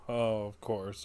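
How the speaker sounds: ordinary talk, nothing marked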